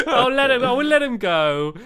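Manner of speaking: high, giggling words; laughing. Real, not acted. Player laughs while letting the golem go, giggling. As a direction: let the laugh spill into it.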